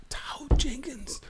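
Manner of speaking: slow, strained whisper of a name; lost-voice whisper. Retold or imitated. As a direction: whisper it slowly, no voice. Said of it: "I said".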